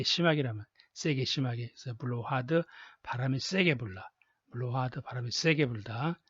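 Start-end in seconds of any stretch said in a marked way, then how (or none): none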